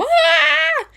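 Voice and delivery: very high, loud startled yelp; chihuahua yelp. Retold or imitated. Imitating a startled chihuahua's high, loud yelp. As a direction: shriek like a scared little dog. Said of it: "small chihuahuas go from".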